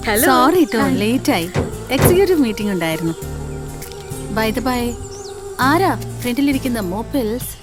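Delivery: loud brash latecomer. Acted. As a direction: boom it out, showing off. Latecomer barges in loudly.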